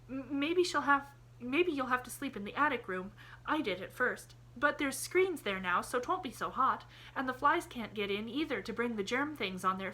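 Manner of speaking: put-on a girl talking nonstop as she hurries; chattering little girl. A narrator's reading.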